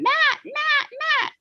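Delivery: very high squawking call of a name; be the cockatoo calling the husband; high bird-voiced call; parrot calling a name. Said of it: "That's how he would call him".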